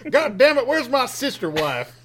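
put-on high, loud hillbilly accent; hammed-up backwoods Southern accent. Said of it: "us talking like this".

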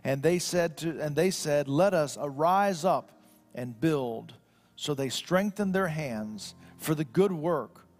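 formal scripture read-aloud. Acted. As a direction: read the passage out solemnly from the pulpit. Reads the Bible passage aloud.

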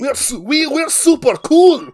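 high, loud, drawn-out Chinese caricature; slow, hammed-up Chinese accent; mock Chinese accent. Captor boasting to hostage, mock Chinese accent. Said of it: "these fucking voices are more".

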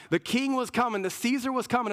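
ordinary delivery

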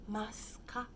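"Mascar" said as mas-ka, the su shrunk to a bare s; su reduced to S. Shown as a demonstration. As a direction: clip the u so "Mascar" sounds like mas-ka. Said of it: "parece um S apenas". ("Mascar" com o su reduzido a um s)

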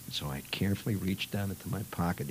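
whispered, secretive telling; hushed narration. Retold or imitated. Narrator reliving the sneaky reveal, whispered.

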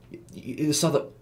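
high, halting stutter, cut off; shocked stammer. A narrator's reading. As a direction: stumble over the words in shock. Shocked girl stammers before being cut off.

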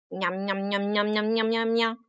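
low, soft nom-nom chewing sound; munching noises. Acted. Monkey feasting on stolen peaches, munching. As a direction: imitate a monkey munching away quietly.

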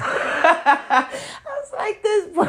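squealing with laughter, high and loud; cracking up at the mugshot; laughing. Real, not acted. Laughing at her friend's mugshot, high and loud.

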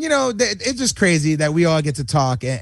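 usual speaking voice

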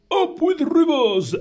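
high, loud hooted chant; hooting duck-billed dinosaurs. A narrator's reading. Duck-billed dinosaurs cheering for rivers, high hooting. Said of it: "They hooted".

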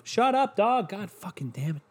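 high-pitched annoyed outburst, dropping off into a grumble; real irritation at an interruption; irritated. Real, not acted. Snapping at his barking dog.

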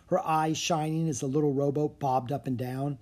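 plain reading voice

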